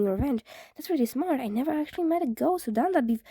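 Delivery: fast, eager rambling questions; rushed questions. Acted. Izuku rattling off questions to the ghost, fast. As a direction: tumble through the questions.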